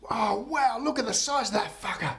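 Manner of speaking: high-pitched acted astonishment; amazed exclamation. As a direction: play an awestruck deckhand, voice shooting up. Deckhand amazed at huge fish.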